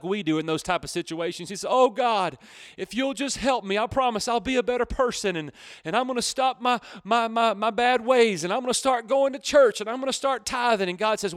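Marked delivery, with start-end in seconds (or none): 1.7-10.9 high, hurried bargaining with God; pleading prayer. Acted. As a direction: plead and promise in a rush. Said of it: "he's praying a prayer".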